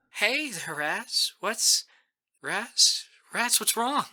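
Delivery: high, worried friend's voice on the phone; greet, then grow alarmed, pitched high; frightened concern. A narrator's reading. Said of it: "Mamie's frightened at the sound of me".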